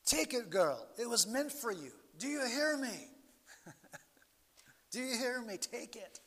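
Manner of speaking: put-on Italian-accented dad urging the crying girl to take communion; broken English Italian accent. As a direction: urge her in a heavy Italian accent. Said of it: "in his broken English Italian accent".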